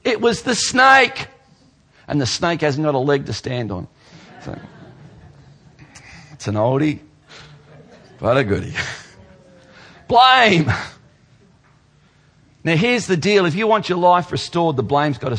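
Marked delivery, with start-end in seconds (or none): 0.0-1.3 much higher, slow, pointed accusation; mock Eve, high and loud; Eve blaming. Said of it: "Eve says". 10.1-10.7 one loud, high punched word; sudden loud high declaration; emphatic. Real, not acted. Preacher names the old sin.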